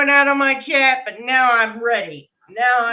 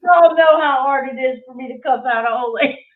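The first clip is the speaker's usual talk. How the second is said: louder, laughing all through the line; confessing while cracking up; 2 semitones higher, 5 dB louder than usual. Real, not acted. To her viewers about cussing old ladies, laughing.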